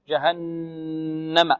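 very slow جهنم with a long nasal n; soft جهنم, n held through the nose; full ghunna. Shown as a demonstration. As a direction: hum on the doubled ن of جهنم. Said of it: "النون كذلك مشددة، غنتها أكمل".

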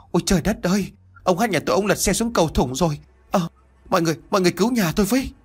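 high, frantic cry for help; screaming in despair. Acted. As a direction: wail to the villagers, rushing at the end. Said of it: "hét lớn". (vợ gào khóc kêu cứu chồng)

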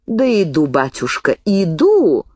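ordinary delivery, nothing marked

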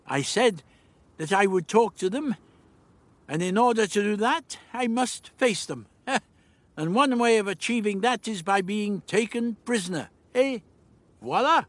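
eccentric, high-pitched glee; chuckling old Doctor. Acted. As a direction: explain your scheme with little chuckles. Doctor explains plan.

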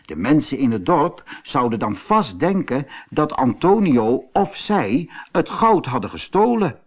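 ordinary delivery, nothing marked

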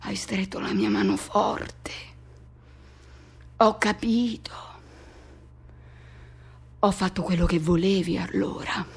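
barely breathed, a soft whisper. Acted. To the lover, recalling the hand held tight, whispered. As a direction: let the words go out on breath alone. Hushed confession of the moment.

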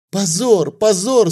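high, loud tearful wail; tearful cry. A narrator's reading. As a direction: cry out in tears. Annushka in tears.

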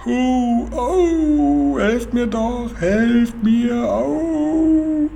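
high, long-drawn howls for help; whimpering wail. A narrator's reading. Boy fakes agony to fool the robber, whimpering. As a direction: wail as if in terrible pain. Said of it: "wimmerte weiter".

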